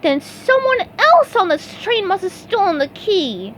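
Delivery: very high, eager cartoon sponge; bright squeaky helper ready to go; eager SpongeBob voice. A narrator's reading. SpongeBob volunteering to his boss, high eager voice.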